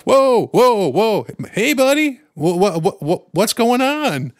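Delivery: high, soothing, placating fix-it voice; calm the scared kid down, high and loud; soothing buddy voice. Retold or imitated. To the startled boy after nearly punching him, a high placating fix-it voice.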